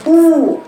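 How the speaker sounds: «أو» عميقة من الجوف، أعلى وأقوى; أخرج «أو» من الجوف بقوة; مشاركة الجوف. Shown as a demonstration. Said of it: "شايفة مشاركة الجوف إزاي". («أو» رنانة بضم ومشاركة الجوف)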